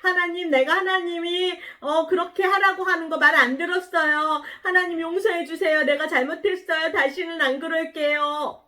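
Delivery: high, wailing mock plea; pleading prayer. Jonah praying inside the fish, pleading for forgiveness. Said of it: "기도했어요".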